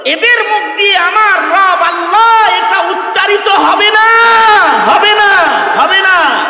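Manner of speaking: loud preaching shout. Acted. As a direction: hammer the denial out loud. Preacher's climax, repeated denial.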